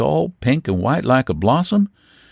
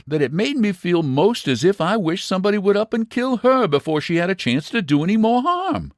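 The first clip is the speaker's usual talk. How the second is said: ordinary delivery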